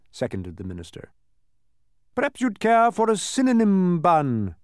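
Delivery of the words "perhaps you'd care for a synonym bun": high loud duke. A narrator's reading. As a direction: offer it grandly, high. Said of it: "suggested the duke".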